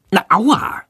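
moderate, high-pitched pain cry. A narrator's reading. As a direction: yelp "ow" up high. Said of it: "schreit Jendrik laut".

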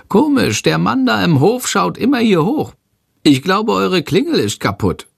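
hoch, aufgeregt rufend; rufende Fledermausstimme. A narrator's reading. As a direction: kleines Wesen, das hektisch ruft. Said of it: "ruft Shandor". (Shandor ruft den Jungen, hohe hektische Stimme)

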